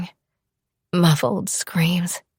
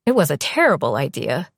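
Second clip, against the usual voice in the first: ordinary delivery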